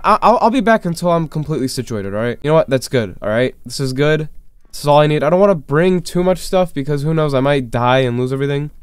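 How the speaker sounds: ordinary delivery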